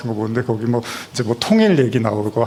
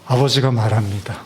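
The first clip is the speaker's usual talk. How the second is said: usual speaking voice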